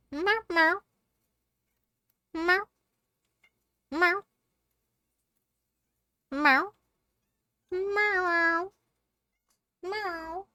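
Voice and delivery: very high squeaky copied meows; high meow imitation. Answering her cat, squeaking high meows back.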